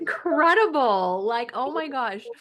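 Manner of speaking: moved close to tears; real tears breaking through the words; tearful. Real, not acted. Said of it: "I'm, like, literally crying right now".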